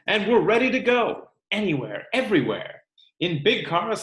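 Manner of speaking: lively read-aloud, bigger on the lists; storybook reading for a classroom; reading to children. Acted. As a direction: read the book to the class with energy. Teacher reads book to class.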